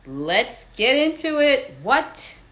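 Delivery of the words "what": normal talking voice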